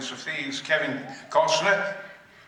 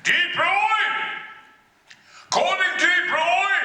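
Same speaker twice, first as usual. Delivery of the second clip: mock shout; 11 semitones higher, slower than usual. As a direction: yell the name like a studio call. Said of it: "they suddenly shouted".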